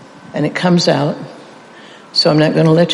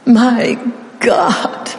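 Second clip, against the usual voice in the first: higher, breaking voice crying out to God; anguished cry; 5 semitones higher than usual. Acted. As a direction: let the second cry crack with anguish. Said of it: "repeating it".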